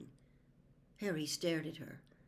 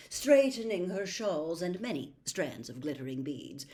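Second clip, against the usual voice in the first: usual speaking voice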